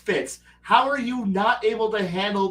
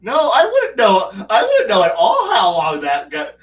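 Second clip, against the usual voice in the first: normal talking voice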